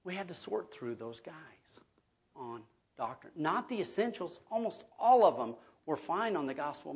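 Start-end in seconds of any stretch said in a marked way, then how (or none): 0.0-3.3 whispered, much softer and breathless; hushed aside. Real, not acted. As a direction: drop to a confiding whisper. Confiding aside about screening applicants.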